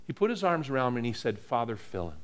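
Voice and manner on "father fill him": low and soft, an intimate prayer; murmured prayer. Retold or imitated. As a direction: pray it quietly over him. Joe praying over him.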